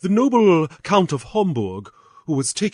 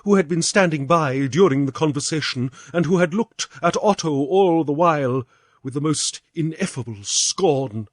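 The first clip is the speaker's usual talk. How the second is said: usual speaking voice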